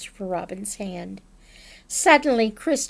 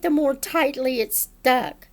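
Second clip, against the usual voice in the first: plain reading voice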